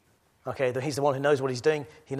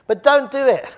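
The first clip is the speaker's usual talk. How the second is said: loud, high preacher's battle cry; full-voiced rallying call to the church; rallying shout; 12 semitones higher, 14 dB louder than usual. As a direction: rouse the congregation to stand firm. Rallying cry continues, half joking.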